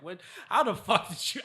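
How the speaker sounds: cracking up while quoting his own shock; laughing disbelief. Real, not acted. Laughing at his friend's failing grades, real laughing disbelief.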